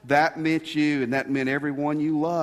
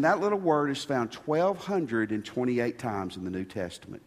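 ordinary talk, nothing marked

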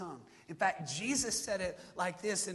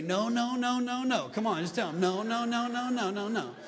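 loud, higher, head-bobbing sass, drawn out; sassy attitude; 5 semitones higher, 10 dB louder, slower than usual. Shown as a demonstration. Pastor leading congregation to refuse gossip with sass. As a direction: wag a finger and shut the gossip down with sass. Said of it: "let's do a little attitude".

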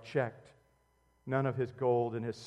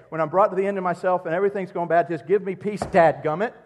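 fast, louder exasperated complaint; frustrated outburst; 4 semitones higher, 10 dB louder, faster than usual. Retold or imitated. Preacher acting his own frustration at home, fast and louder. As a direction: rush it out, fed up.

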